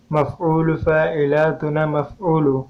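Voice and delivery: slow, syllable-by-syllable verse recitation; measured scansion. Shown as a demonstration. Teacher showing students the verse's metre, slow measured scansion. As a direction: draw out each metrical unit evenly.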